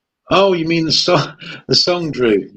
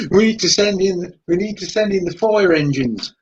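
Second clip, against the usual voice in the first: put-on higher comic Australian accent; mock Australian; 6 semitones higher than usual. Calling for help in Skippy joke, mock Australian accent. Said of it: "kind of like northeastern Australia".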